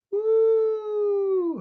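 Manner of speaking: very high stunned woo; stunned exhale. Real, not acted. Vlogger hit by a painful idea, stunned cry. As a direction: blown away by the thought.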